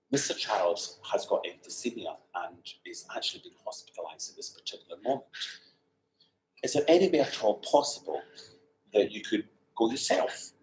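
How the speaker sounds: soft, measured phone message; hushed formal caller. Retold or imitated. Caller asking her to replace Mister Charles, hushed and formal.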